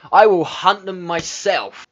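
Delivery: hammed-up defiant shout. Player answers a doubter as the king, hammed-up shout. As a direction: boom it out in mock defiance.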